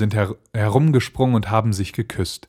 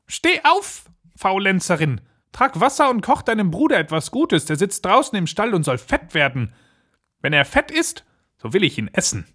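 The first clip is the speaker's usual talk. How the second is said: high-pitched, bossy witch; witch calling out; 11 semitones higher than usual. Acted. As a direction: order her about in a high witch voice. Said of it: "rüttelte sie wach und rief". (herrisch rufende Hexe)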